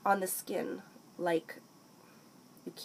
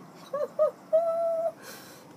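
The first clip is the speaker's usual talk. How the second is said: very high, drawn-out falsetto laugh; delighted high hoot. Real, not acted. Vlogger thrilled by the powder, high hooting laugh.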